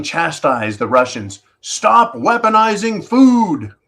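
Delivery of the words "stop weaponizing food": high, slow, scornful imitation of a scolding; mocking quote. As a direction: parrot the lecture back with sarcasm. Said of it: "And they're being told".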